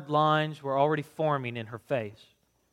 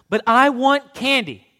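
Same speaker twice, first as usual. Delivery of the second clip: put-on high, loud, slow child's whine; tugging at mom's jacket, slow pleading whine; whining boy; 10 semitones higher, 10 dB louder than usual. A narrator's reading. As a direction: drag it out like a sulking boy. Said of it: "complained some more".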